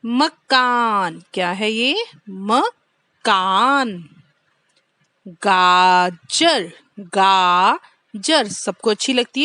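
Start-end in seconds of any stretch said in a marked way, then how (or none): 0.1-1.2 मकान drawn out slowly, falling; slow syllable drill. Shown as a demonstration. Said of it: "तीन अक्षर वाले शब्द भी सीखेंगे". 2.3-4.1 मकान said very slowly; slow drill. Shown as a demonstration. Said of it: "तीन अक्षर वाले शब्द भी सीखेंगे". 5.3-8.5 गाजर dragged out slowly twice; slow drill. Shown as a demonstration. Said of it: "तीन अक्षर वाले शब्द भी सीखेंगे".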